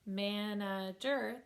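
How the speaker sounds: "manager" slowly split man-a-ger; three measured beats on "manager"; syllable by syllable. Shown as a demonstration. Teacher repeating the clapped word, "manager" split into three syllables. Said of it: "that word has three syllables".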